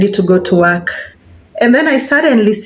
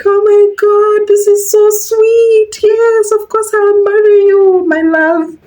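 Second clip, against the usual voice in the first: high, slow, swooning yes; hammed-up dreamy delight; gushing acceptance; 12 semitones higher, slower than usual. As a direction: overplay the sweet, lovestruck bride. Accepting the proposal, acted.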